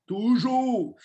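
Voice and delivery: high, loud "Toujours!" shouted hard; rally the team, shouting the rule; emphatic shout. Real, not acted. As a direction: bang the table with "Toujours!". Hammering home his key rule.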